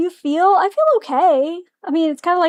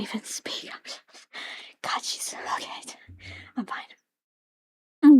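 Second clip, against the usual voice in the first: awed, slow whisper with a small laugh; whisper, too flustered to speak; breathless whisper; 2 semitones lower, 6 dB quieter, much slower than usual. Real, not acted. Overwhelmed by the game scene.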